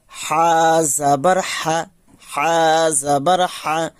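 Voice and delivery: slow, deliberate হা জাবার হা; slow spelling recitation. Shown as a demonstration. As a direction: spell it out slowly for students. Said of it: "উচ্চারণ কেমন হবে?". (ধীরে ধীরে বানান করে পড়া)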